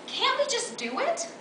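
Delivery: high-pitched, rising appeal; squirming, high question; embarrassed plea. Acted. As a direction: plead to get it over with, voice lifting high.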